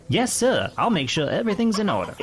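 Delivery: put-on high-pitched West Virginia boy; West Virginia accent. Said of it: "trying to do a West Virginia accent".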